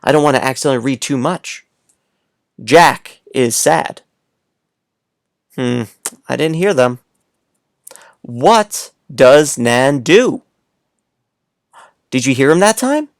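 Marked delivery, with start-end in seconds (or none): 2.6-4.0 put-on slow, careful read to young kids; slow reading aloud. Shown as a demonstration. Teacher rereading a sentence slowly to little kids. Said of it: "let's read these one more time". 8.2-10.4 put-on slow, word by word read to young kids; slow reading aloud. Shown as a demonstration. Teacher reading a sentence slowly to little kids. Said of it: "let's read these one more time".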